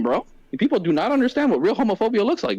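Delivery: normal talking voice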